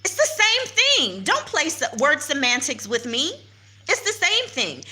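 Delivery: retold heated retort. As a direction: push back hard on the officer. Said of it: "I said".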